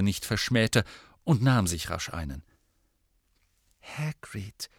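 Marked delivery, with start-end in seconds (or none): 3.8-4.7 soft, hesitant name; timid. A narrator's reading. Hermione cautiously addressing the angry Hagrid, very soft. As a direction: barely dare to say it. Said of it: "sagte Hermine zaghaft".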